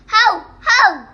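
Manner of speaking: loud barked how how; dog barking. Shown as a demonstration. Child showing the class a loud dog bark. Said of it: "Dog sounds".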